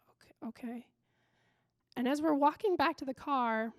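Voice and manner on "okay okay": very soft, chastened murmur; tiny, hushed reply; meek acceptance. Retold or imitated. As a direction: imitate a kid quietly giving in. Chastened kid gives in.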